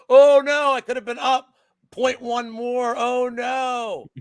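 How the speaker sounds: high, drawn-out sarcastic wail; mock lament. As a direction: wail in fake despair over a trifle. Sarcastic wail over a trivial bet.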